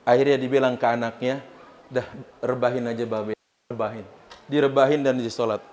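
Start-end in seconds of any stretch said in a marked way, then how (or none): 1.9-4.0 weak, faint. Acted. Wounded father whispers weakly.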